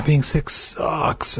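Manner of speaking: slow, breathy whispered aside. Real, not acted. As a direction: whisper it wearily.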